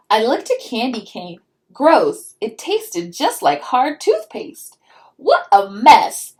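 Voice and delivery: lively storybook read-aloud; reading to children. A narrator's reading. Mom reading a picture book to her child, storybook voice.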